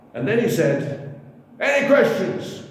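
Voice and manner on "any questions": mocking challenge. Atheist leader challenges crowd.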